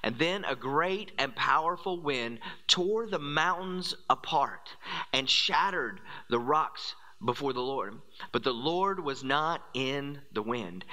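ordinary talk, nothing marked

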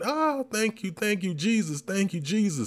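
high, ecstatic thank-yous at full voice; mock ecstatic screaming. Storyteller imitating a lady at the altar, ecstatic. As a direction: cry out thanks like a woman overcome in church. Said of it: "She's screaming".

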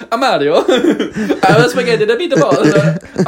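hammed-up Italian accent, laughing; high laughing Italian accent. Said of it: "talk an Italian accent".

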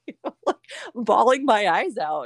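talking through real laughter; laughing. Real, not acted. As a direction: giggle while describing the tears. Laughing at her own crying.

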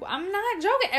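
high-pitched protest; retold protest. Nobody believed she was pregnant, retold protest.

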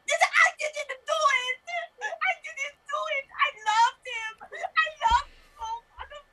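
high, wailing, crying plea; tearful protest. Acted. Accused woman insists she loved him, high tearful wail. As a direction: cry out in melodramatic grief.

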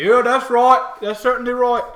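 very loud, high orc cheer; agreeing orcs. Acted. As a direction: agree boisterously as an orc guard. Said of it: "they laugh, say".

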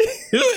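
quick high burst of laughter; laughing at his own retold reply. Real, not acted. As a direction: laugh at the memory.

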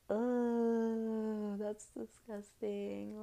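slow, drawn-out grossed-out voice; mock disgust. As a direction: drag it out, totally grossed out. Speaker's disgusted reaction.

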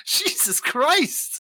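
high, slow, stretched-out disbelief; mock outrage. Real, not acted. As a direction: plead to the heavens in comic outrage. Mock outrage over wife's fate.

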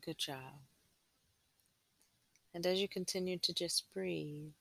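guided meditation. Acted. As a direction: gently guide them inward, unhurried.

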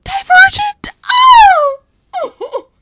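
very high, loud outburst; piercing squeal of disbelief at your own blunder; self-mocking shriek. Real, not acted. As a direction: shriek the wrong title back at yourself. Shrieks at own wrong film.

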